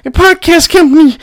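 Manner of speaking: very high squeaky mock voice. Mocking haters threatening his podcast, very high and squeaky. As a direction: squeal it like a tattletale. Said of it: "People are like".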